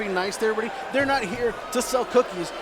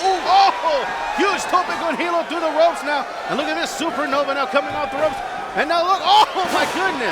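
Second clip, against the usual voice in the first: excited play-by-play; 4 semitones higher than usual. Real, not acted. Commentator reacting to a big dive.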